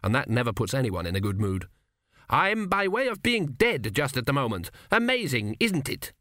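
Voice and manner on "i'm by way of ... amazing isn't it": bitter. A narrator's reading. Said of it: "he said bitterly".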